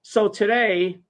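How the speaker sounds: ordinary talk, nothing marked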